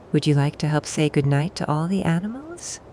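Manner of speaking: usual speaking voice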